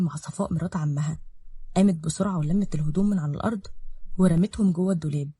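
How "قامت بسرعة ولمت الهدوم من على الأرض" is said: ordinary delivery, nothing marked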